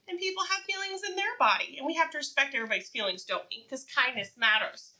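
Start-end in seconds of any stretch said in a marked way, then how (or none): none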